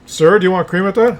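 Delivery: a barista raising her voice at a distracted customer; repeated question, pushier; impatient barista.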